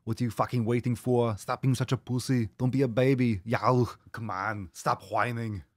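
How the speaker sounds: put-on thick Austrian-accented tough trainer; Arnold Schwarzenegger. Streamer bullies viewers into starting, mock Arnold impression. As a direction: berate them like an Austrian bodybuilder. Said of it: "Arnold voice".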